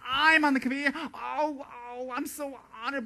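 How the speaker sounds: high, breathless honoured gush; fake humble delight. New committee member gushing, high fake humility. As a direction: gush over the honour, falsely.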